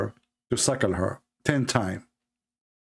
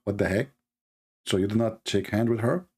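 ordinary delivery

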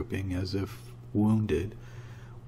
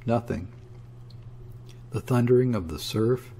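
ordinary delivery, nothing marked

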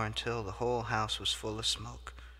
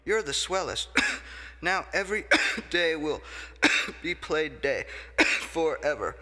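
higher and louder joy broken by loud coughs; happy coughing fit; 6 semitones higher, 6 dB louder than usual. Acted. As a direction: gush with happiness while choking on coughs. Thrilled, coughing on each word.

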